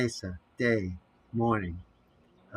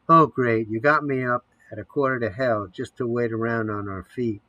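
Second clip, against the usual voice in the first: plain reading voice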